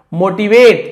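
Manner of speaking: Motivate called out high and loud; teacher's loud model of motivate; loud model word. Shown as a demonstration. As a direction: pronounce motivate loudly with a falling tune. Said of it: "तो मैंने लिख दिया motivate".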